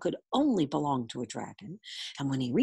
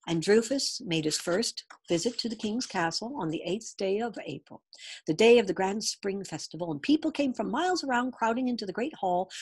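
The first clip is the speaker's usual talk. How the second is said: usual speaking voice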